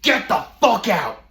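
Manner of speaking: high, loud, slow mock bellow; imitating an angry yell. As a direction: yell it at the door like the furious roommate. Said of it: "he says".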